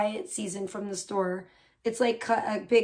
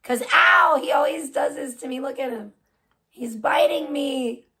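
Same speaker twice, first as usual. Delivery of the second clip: ordinary talk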